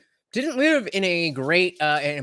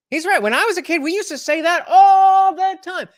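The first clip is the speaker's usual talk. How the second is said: animated, high and loud agreement; eager, pitched-up agreement; excited; 12 semitones higher, 8 dB louder than usual. Real, not acted.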